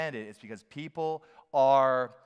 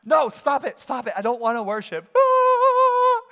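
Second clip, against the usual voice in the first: high squealing cries of no, stop it; hammed-up protesting spirit; 11 dB louder, slower than usual. Preacher voicing the spirit resisting worship out in creation, shrieking. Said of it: "your spirit's saying".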